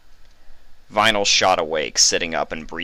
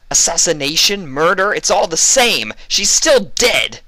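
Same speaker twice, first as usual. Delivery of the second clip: a much higher, loud furious yell; angry barking; 12 semitones higher, 9 dB louder than usual. A narrator's reading. Vinyl raging at the interrogator, barking. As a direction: bark it, growing angrier. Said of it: "Vinyl barked".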